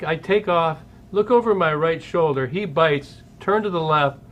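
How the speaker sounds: usual speaking voice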